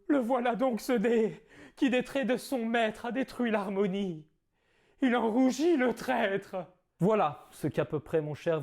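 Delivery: theatrical Cyrano declaiming; grand stage verse delivery; hammy stage actor. Acted. Performs Cyrano's nose tirade.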